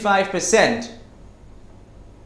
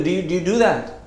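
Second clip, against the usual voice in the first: normal talking voice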